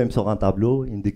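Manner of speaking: ordinary delivery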